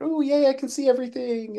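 high, excited little cheer; happy squeal. Retold or imitated. Hobbyist delighted to see all the paint pot stands again, happy squeal. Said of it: "I was so happy".

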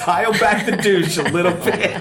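high, laughing repeat of a joke. Real, not acted. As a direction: barely get it out through laughter.

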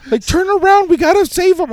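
very high, squealed mock panic; imitated panicked screaming. Cousin in the fleeing car begging to go back, panicked screaming. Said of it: "his cousin's in the car screaming".

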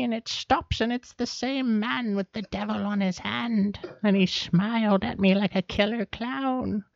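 put-on high, wavering little old lady. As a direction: play a sweet, rambling old lady. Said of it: "I love doing my little old lady voice".

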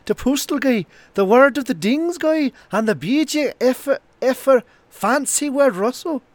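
put-on high, slurred drunken Irish voice, slowing down on the last words; a pub regular reading a fan letter in a drunken Irish accent. Reading a listener's review on the podcast, high and slurred in a drunken Irish accent. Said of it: "do this review in a drunken Irish accent".

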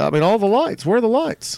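high, indignant complaint; mock outrage. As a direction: play it up as scandalized. Outraged over missing lights.

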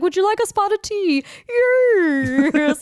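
put-on high, posh-parody British accent. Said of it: "dress up as British people".

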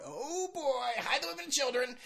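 high hammed oh boy; mock excitement. Teasing listeners about his wild time, mock excitement. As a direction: tease a wild time ahead.

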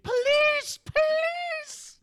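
high-pitched, loud cry for help; yelling housekeeper. Retold or imitated. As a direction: shriek it like a housekeeper yelling in the street. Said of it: "their housekeeper yelling in the street".

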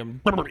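rolling lip-flutter "brrr"; echoed burring noise. Pretend. Friend copying the grizzly bear noise, playful lip burr. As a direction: flutter the lips in playful imitation.

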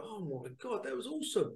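high-pitched retold gushing; mock awe, pitched well up; imitating amazed viewers. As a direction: gush like an impressed fan. Voicing viewers' amazed reactions.

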